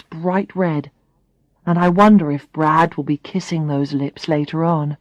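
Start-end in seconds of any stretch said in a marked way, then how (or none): none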